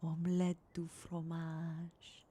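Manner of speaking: slow, hushed French accent; very soft, lingering French accent. Shown as a demonstration. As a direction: murmur it slowly in a French accent. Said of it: "in a soft French accent voice".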